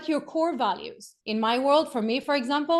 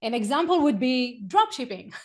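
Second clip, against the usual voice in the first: usual speaking voice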